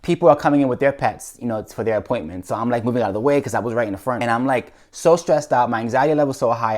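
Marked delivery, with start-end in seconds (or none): none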